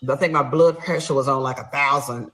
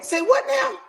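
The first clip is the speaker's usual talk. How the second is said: very high incredulous yelp; stunned disbelief, pitch shooting up; shocked; 3 dB louder than usual. Retold or imitated. Wife hearing her husband admit his affair, shocked.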